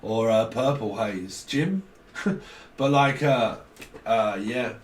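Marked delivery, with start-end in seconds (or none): none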